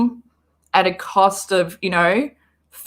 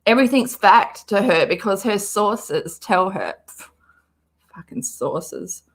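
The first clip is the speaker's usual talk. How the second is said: usual speaking voice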